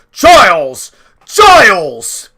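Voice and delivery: loud calling out across the steps; shouting a name. A narrator's reading. Grandpa calling loudly for his servant Giles. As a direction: bellow for the servant, falling at the end.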